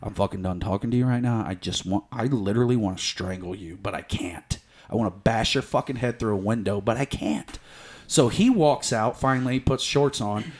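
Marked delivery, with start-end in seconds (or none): 0.0-7.6 low, soft, tightly controlled threat; cold restrained fury. Retold or imitated. Man telling his cheating girlfriend off, low restrained fury. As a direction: hold the rage back, keep it quiet. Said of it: "I go".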